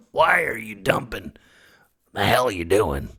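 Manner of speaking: gruff, indignant complaint through a sick throat; raspy Nick Nolte type; Mother Earth. Acted. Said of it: "Does Mother Earth sound like Nick Nolte?".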